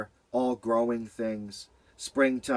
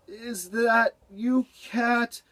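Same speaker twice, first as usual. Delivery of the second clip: sleepy; 11 semitones higher, much slower than usual. Acted. Beaver just woken, drowsy.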